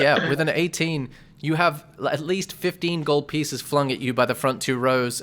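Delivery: normal talking voice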